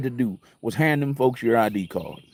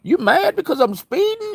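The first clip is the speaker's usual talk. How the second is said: high mocking squeak of a question; silly teasing. Teasing the angry cop over speeding, squeaky and silly. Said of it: "I'm being silly the whole time".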